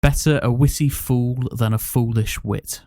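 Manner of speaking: usual speaking voice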